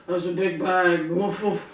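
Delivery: woofs fading to breathy; barking dog. Acted. Dog answers the hen, barking woofs. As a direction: bark then refuse. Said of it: "said the dog".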